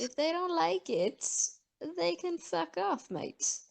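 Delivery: put-on a muddled Aussie-British accent with attitude; mock Aussie accent. Brushing off critics in a fake Aussie accent. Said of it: "I don't even know what accent that was".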